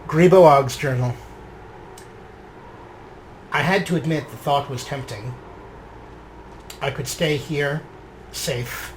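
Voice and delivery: measured, reflective diary reading; journal narration. A narrator's reading. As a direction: read it like a character's private journal. Reading his character's journal aloud.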